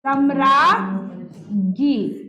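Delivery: slow, drawn-out सम्राज्ञी; the right word, spoken slowly to teach it; correct form. Shown as a demonstration. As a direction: stretch out सम्राज्ञी slowly, every syllable. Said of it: "समझ गए?".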